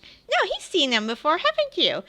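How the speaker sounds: put-on high insistent child; squeaky kid insisting. Child answers back.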